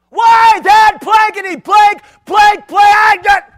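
furious shouting dad; hammed-up furious shout; angry cussing. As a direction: yell it like a deacon losing it in traffic.

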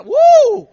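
high loud whoop; a loud high celebratory whoop; excited whoop. Real, not acted. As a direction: let out a big high woo. Preacher reacts to crowd.

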